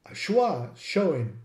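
ordinary talk

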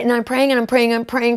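ordinary talk, nothing marked

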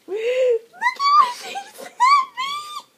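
very high, loud, slow shriek with laughter; shrieking excitement. Real, not acted. Vlogger reveals the handmade hat to viewers in a shriek.

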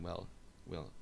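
ordinary talk

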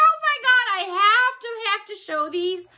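high-pitched gushing, easing down at the end; excited retold reaction. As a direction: re-enact a high, giddy outburst. Retells excitement at seeing them.

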